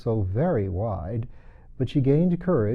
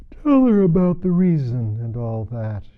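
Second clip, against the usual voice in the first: sleepy Mock Turtle; 3 semitones higher, 8 dB louder than usual. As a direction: wave it off, half asleep.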